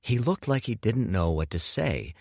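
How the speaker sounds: ordinary delivery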